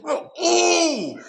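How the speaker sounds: very high, stunned ooh; mock-shocked squeak. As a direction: let it slip out in shock. Stunned reaction to interview.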